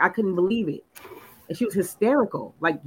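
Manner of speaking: ordinary talk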